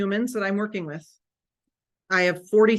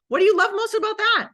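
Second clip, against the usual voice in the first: much higher, louder, gushing interest; eager demo question; 11 semitones higher, 6 dB louder than usual. Shown as a demonstration. Coach demonstrating a follow-up question, eagerly. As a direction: lean in and sound thrilled. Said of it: "lean in and ask more questions".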